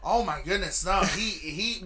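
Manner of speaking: ordinary talk, nothing marked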